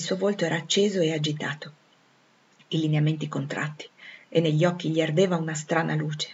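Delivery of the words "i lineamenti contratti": ordinary delivery, nothing marked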